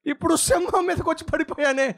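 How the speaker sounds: frightened. Acted. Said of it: "భయపడిపోతూ".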